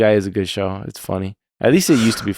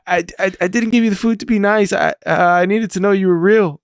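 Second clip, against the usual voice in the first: imitating Solo, stammering and much higher; 9 semitones higher than usual. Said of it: "So he's like".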